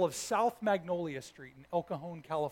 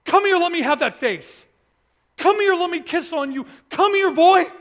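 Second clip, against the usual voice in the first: louder and higher, swelling with delight as each call builds; affectionate loving God; 9 semitones higher, 12 dB louder than usual. Retold or imitated. Preacher voices God welcoming his son home, louder and rising. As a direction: call him in for a hug, growing more loving each time. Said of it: "What he showed up with immediately was".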